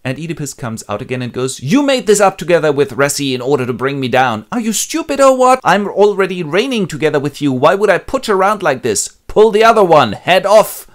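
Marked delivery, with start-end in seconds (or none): 1.6-10.9 high, very loud ranting; furious king. Acted. Oedipus raging at Creon, high and very loud. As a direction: bellow the accusation.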